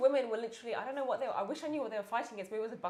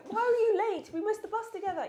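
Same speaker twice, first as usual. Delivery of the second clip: mock quarrel; 11 semitones higher, 5 dB louder than usual. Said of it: "they're probably like".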